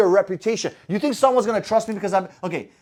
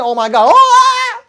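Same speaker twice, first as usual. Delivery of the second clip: much higher and louder, slow hammed-up panic; mock panic; 11 dB louder, slower than usual. Coach to his audience, recalling a first car crash, hammed-up panic. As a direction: ham up the shock of a first crash.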